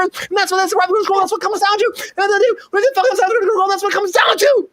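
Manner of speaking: high, rapid-fire run-on rant; high-pitched patter with hardly a pause for breath; auctioneer. Real, not acted. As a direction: rattle it off like an auctioneer on a roll. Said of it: "You sound like an auctioneer".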